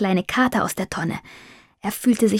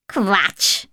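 lightly rasped raven voice; a touch of raven rasp. A narrator's reading. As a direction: rasp out a brusque dismissal. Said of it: "schnarrte Jakob".

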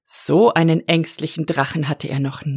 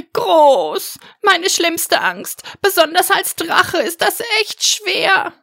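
hohe, helle Drachenstimme, ängstlich; kleiner Drache; 13 semitones higher, 3 dB louder than usual. A narrator's reading. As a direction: spricht als kleiner, verzagter Drache. (hohe Stimme des kleinen Drachen, der Max seine Angst gesteht)

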